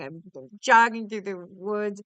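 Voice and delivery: ordinary talk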